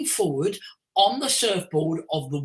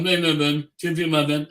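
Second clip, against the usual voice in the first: low monotone droning; 4 semitones lower, 4 dB louder than usual. Shown as a demonstration. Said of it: "like a bassoon".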